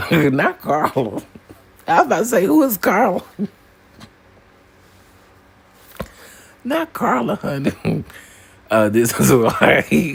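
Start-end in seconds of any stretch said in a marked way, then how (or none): 0.1-8.0 amused disbelief. Real, not acted. Laughing reaction to chat.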